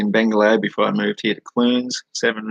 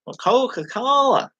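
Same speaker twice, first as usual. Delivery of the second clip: Coca-Cola stretched slow and much higher, American style; exaggerated American accent; slower than usual. American clerk correcting him, slow mock American. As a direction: overpronounce Coca-Cola like a Yank. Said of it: "they speak so slow and exaggerated".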